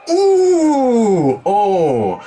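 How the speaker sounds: high wincing ooh; pained ooh. Acted. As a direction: wince at a bad joke. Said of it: "Hoppy's like".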